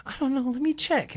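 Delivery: very high imitated saleswoman voice; high-pitched, obliging shop assistant. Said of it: "And she goes".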